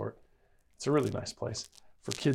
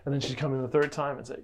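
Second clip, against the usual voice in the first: normal talking voice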